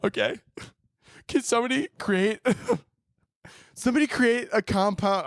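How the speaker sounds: high mock sobbing, words breaking up; teaching through tears. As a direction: struggle through a question while crying. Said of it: "I would just start crying up there".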